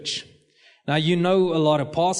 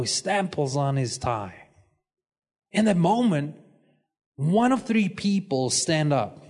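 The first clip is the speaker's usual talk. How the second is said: ordinary talk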